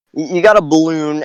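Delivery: ordinary delivery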